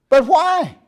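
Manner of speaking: high, loud pleading why; whiny objector. Retold or imitated. Preacher voicing a member demanding reasons, high and whiny. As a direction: whine like a stubborn churchgoer. Mock dialogue with congregant.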